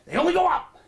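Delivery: They only go up shrieked very high and loud. Acted. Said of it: "repeated line, high then low".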